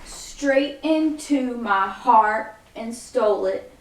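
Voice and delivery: very slow, drawn out to the moves; slow counted lyric. Shown as a demonstration. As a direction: stretch the lyric across the beats. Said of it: "it goes".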